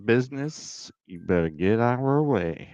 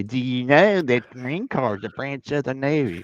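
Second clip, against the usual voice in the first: lightly voiced smug questioner, a bit higher; mock know-it-all; 5 semitones higher than usual. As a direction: ask it like a know-it-all who thinks he's clever. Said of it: "I still get people say".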